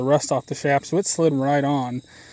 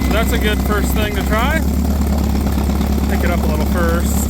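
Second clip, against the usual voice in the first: raised voice over a rumbling tractor; talking over tractor noise; 7 semitones higher, 5 dB louder than usual. Real, not acted. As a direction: call it out over the engine noise. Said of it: "sorry about the tractor noise".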